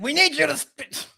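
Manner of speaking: high, put-on mock coach voice; a hammed-up coach yelling gibberish at his players; mock coach in Arabic. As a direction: ham up an angry coach barking in Arabic. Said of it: "imagine if he does it in Arabic".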